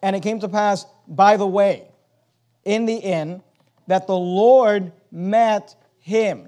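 solemn scripture reading with pauses; weighty reading. Shown as a demonstration. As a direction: let each word of the verse land. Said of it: "notice just the words".

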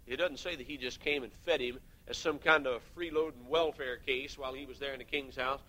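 usual speaking voice

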